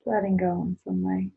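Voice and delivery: guided meditation. Acted. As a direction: guide the listener calmly into their body. Leads a closing meditation.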